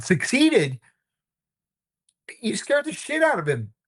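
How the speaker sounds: high, disbelieving retort; incredulous. Retold or imitated. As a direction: throw his word back at him. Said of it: "And I said".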